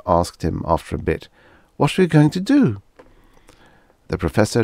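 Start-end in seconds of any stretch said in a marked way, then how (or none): 1.8-2.8 higher woman voice; wife voice. Acted. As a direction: ask as the anxious wife. Wife asks in story.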